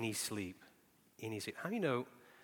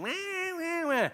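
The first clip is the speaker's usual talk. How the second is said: much higher mock infant crying; baby wail imitation; 7 dB louder, much slower than usual. Father imitating his baby crying harder, high wail. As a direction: wail like a newborn.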